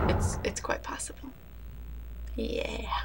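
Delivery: soft unvoiced whisper to the viewers; whispered aside. Real, not acted. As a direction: drop to a breathy secret whisper.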